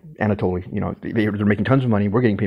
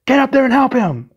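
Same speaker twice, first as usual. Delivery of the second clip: boss shouting; 5 dB louder than usual. Retold or imitated. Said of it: "They were like".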